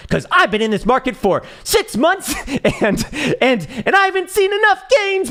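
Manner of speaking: high, loud whiny mock voice; shrill parody of a bullish holder demanding a Santa rally; mocking a greedy holder. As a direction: squeal it like an entitled crypto bro begging for gains. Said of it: "what the fuck did I just hear".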